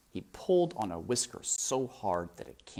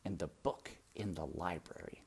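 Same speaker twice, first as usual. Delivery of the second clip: whisper; 4 semitones lower, 11 dB quieter than usual. A narrator's reading. Reading aloud, drops to whisper.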